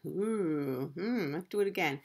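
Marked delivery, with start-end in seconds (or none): none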